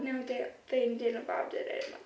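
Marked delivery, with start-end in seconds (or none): none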